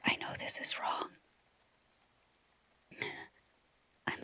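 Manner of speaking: unvoiced whisper of unease. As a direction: whisper it as if creeped out. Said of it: "you're like".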